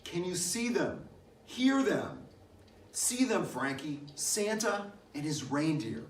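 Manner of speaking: high-pitched excited calling out, easing by the end; father's excited cry to his child; excited shout. A narrator's reading. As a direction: call out as if pointing out a wonder in the sky. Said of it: "and he shouted".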